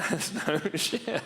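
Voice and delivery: slow, high words through real laughter; can barely say it for laughing; cracking up while insisting it's true. Real, not acted. Cracking up at own joke.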